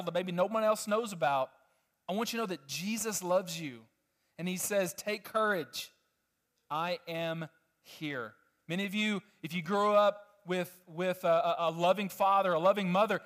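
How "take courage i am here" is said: tender reassurance. Acted. Jesus voiced softly.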